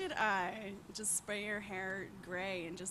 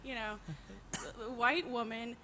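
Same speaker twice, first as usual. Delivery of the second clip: ordinary talk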